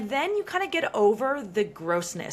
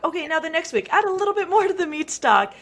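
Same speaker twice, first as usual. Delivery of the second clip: higher and louder, stressing more; emphatic next step; 6 semitones higher, 5 dB louder, faster than usual. Shown as a demonstration. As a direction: build it up for week two. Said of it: "Con-, oh".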